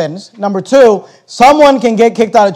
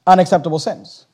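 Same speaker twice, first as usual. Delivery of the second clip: ordinary talk, nothing marked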